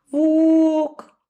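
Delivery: put-on high childish call; the sheep calls the wolf from outside the door; little sheep calling. A narrator's reading. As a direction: call out like a small lost lamb. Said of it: "звала она".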